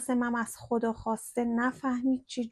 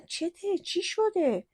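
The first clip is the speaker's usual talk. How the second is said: higher, startled questions; alarmed asking; 8 semitones higher, 4 dB louder, much slower than usual. A narrator's reading. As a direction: ask her what happened, scared. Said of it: "ترسیدم و گفتم". (پرسش هراسان و بالا)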